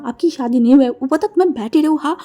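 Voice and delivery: loud, outraged retort; angrier threat. Acted. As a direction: fling the words back at him loudly. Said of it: "गुस्से में wing को धमकाते हुए बोला". (और गुस्से में ज़ोर से धमकाना)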